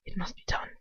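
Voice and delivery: soft high hushed line; quiet. A narrator's reading. Captor says quietly before killing her. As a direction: say it quietly, almost to himself. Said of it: "he says quietly".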